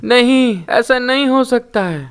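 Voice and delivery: high, loud anguished wail; sobbing no in despair; loud crying. A narrator's reading. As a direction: cry out in grief, pitched high. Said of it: "वो जोर जोर से रोते हुए कहती है".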